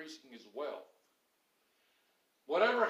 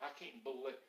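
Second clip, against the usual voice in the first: low muttered grumbling, much softer; grumbled under breath; 9 semitones lower, 15 dB quieter than usual. Retold or imitated. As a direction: grumble it under your lips like a disapproving churchgoer. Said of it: "kind of under their lips".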